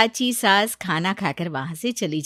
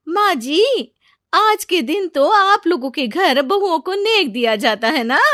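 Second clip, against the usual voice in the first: high, loud, leading question; pointed daughter-in-law; 8 semitones higher, 7 dB louder than usual. Acted. Aunt's daughter-in-law asks about festival gifts for brides, pointed. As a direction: ask loudly so everyone hears.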